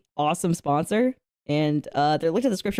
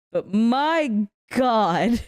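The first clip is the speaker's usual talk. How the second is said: slow, breaking into laughter; laughing; 3 semitones higher, much slower than usual. Real, not acted. Telling chat about her messy crying, laughing hard. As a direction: drag it out through a laugh.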